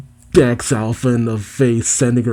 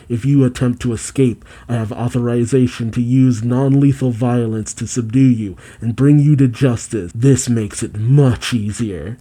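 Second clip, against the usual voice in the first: normal talking voice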